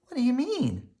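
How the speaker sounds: little sister asking Mama about pressure. A narrator's reading. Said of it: "asked Sister".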